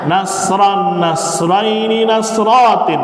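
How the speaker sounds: slow recitation drill. Shown as a demonstration. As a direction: lead the class through each form so they can repeat it.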